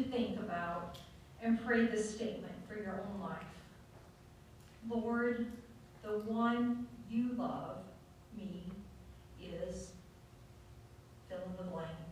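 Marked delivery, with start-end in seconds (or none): 4.9-9.9 prayer. Acted. Pastor leads the bowed congregation, slow gentle prayer. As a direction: pray it slowly and gently.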